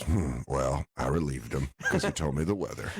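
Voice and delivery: lightly voiced creepy weatherman; mock weatherman. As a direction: murmur it low like a creep. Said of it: "He goes".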